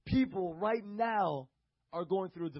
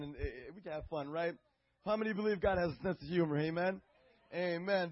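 ordinary delivery

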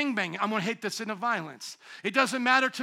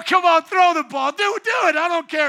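loud teasing taunt, higher; taunting dad; 7 semitones higher, 9 dB louder than usual. Retold or imitated. Dad taunting his angry daughter at dodgeball, loud playful taunt. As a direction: egg her on, loud and playful. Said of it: "I go".

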